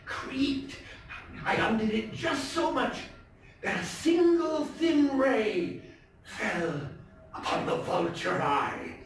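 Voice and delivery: a nervous, wound-up killer confessing in a high, intense voice; tense madman narrator. Acted. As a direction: play the madman insisting he is sane, swinging between hushed and frantic.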